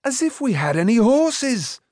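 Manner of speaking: high, indignant protest; crying out. A narrator's reading. Boy objecting to making horseshoes, crying out in protest. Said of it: "Taran cried".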